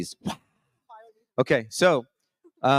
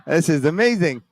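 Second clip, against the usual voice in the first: excited; 10 semitones higher, 6 dB louder than usual. Retold or imitated. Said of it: "I said".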